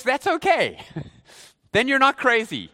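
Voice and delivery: ordinary talk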